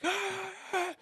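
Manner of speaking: high mock yell sounds through a wall; high mock muffled rant noises. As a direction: imitate distant shouting, wordless. Coach yelling through the wall.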